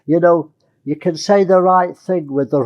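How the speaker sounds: usual speaking voice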